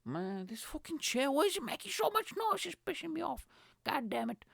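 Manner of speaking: very high, strained comic complaint; hammed-up high-pitched annoyance; exasperated whine. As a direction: whine at the noisy chair in a squeaky voice. Complains about a noisy chair.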